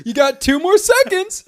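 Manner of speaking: high loud slow mock manager; mock boss voice. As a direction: bark the deadline like a supervisor.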